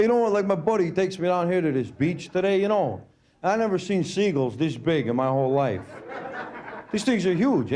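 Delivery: ordinary talk, nothing marked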